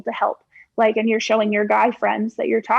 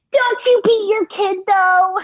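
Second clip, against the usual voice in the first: mocking commenter; 9 semitones higher, 4 dB louder, slower than usual. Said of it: "forty-five seconds of video up is like".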